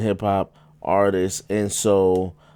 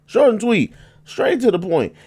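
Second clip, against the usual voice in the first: punchy and emphatic, pitched up; 12 semitones higher, 3 dB louder than usual. Real, not acted. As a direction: hit each word hard. Repeats his catchphrase emphatically.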